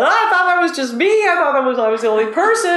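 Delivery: high-pitched, excited disbelief; surprised protest. Retold or imitated. Guide reacting to Rick's news, surprised. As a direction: blurt it high, then settle down. Retells her surprised reply to Rick.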